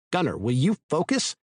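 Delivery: loud, whip-like snap; sharp rebuke. A narrator's reading. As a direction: lash out sharply. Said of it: "voice lashed out like a whip".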